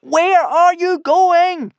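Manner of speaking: very high, slow, drawn-out holler; stretched-out, loud calling; long-distance shout. A narrator's reading. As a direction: holler after someone far off. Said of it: "cupped his hands and shouted".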